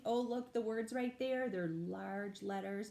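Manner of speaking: ordinary delivery, nothing marked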